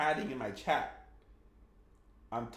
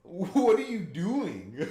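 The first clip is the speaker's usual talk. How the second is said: slow, high, laughing through the words; laughing disbelief; 6 semitones higher, 4 dB louder, much slower than usual. Real, not acted. Laughing at chatter's injury.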